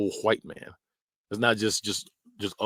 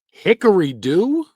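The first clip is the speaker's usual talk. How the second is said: much higher, louder, slow rising; mock folksy question; 9 semitones higher, 9 dB louder, slower than usual. Mocking folksy phrase.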